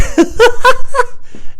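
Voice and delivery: loud, squeaky high cackle; piercing stuttering laugh; own laugh re-enacted. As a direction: act out a helpless high giggle. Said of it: "and I was like".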